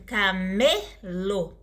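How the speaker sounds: slow "camelo" with a drawn-out "me"; stress on middle syllable. Shown as a demonstration. Teacher giving a paroxytone example, stress on middle syllable. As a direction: break up "camelo" and lean on "me". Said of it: "mais forte se chama paroxítona".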